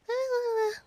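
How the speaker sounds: high-pitched mock "ah"; high squeal. Shown as a demonstration. Host showing how un-serious she sounds when speaking Korean, high squeal. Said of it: "when I'm speaking Korean".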